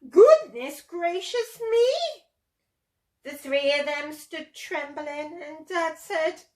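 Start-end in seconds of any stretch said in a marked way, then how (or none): 0.1-2.2 high, loud, slow outcry; shocked exclamation. A narrator's reading. Mother T-Rex seeing the water, loud shocked exclamation. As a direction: exclaim it in big astonishment. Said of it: "and exclaimed".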